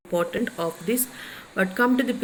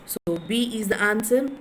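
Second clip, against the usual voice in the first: ordinary talk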